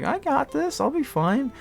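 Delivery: high, drugged, carefree; loopy. Retold or imitated. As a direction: sound giddy and drugged out. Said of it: "hear about me being loopy".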